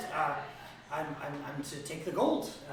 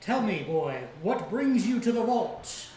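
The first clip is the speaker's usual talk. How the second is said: louder, slow, rising dragon demand; 5 semitones higher, 6 dB louder, slower than usual. Acted. Dragon interrogates Rainier, slow and loud. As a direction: loom and demand slowly. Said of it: "dragon questions Rainier".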